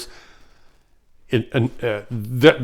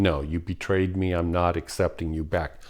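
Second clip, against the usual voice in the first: much softer, lower and resolute; quiet firm refusal; 4 semitones lower, 8 dB quieter than usual. Retold or imitated. Betrayed partner quietly turning the cheater away, firm. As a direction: close the door quietly. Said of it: "but in this lifetime".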